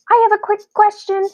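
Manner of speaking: high fast squeaky voice. A narrator's reading. Quentin squeaks in panic during the earthquake. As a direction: squeak it out in a rush. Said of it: "he squeaked".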